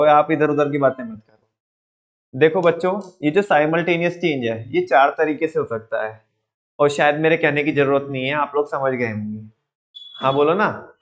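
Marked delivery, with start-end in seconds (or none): none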